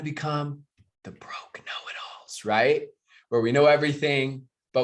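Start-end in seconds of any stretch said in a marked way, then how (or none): 0.0-2.4 hushed aside, no voice; whisper. Real, not acted. Coach to his team, whispering about broke know-it-alls. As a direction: whisper it like a secret warning.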